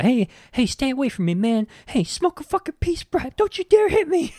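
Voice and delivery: high, put-on voice begging off a beating; panicky Carl. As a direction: blurt it out, scared but still mouthing off. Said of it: "He blurts out".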